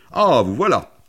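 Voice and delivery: loud, high complaint; high-pitched boyish outcry; bored boy exclaiming. A narrator's reading. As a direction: call out in bored impatience. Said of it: "s'écria le garçon qui s'ennuyait".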